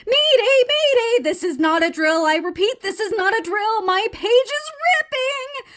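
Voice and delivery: high, loud mayday call; panicked alarm. Acted. Frog's page is ripping, frantic alarm. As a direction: sound the emergency, rising to a wail.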